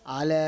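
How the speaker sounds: "আলা" with a very long last vowel; long sustained ending on "আলা"; three-alif elongation. Shown as a demonstration. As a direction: hold "লা" of "আলা" on one level note. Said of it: "তিন দাগ আছে".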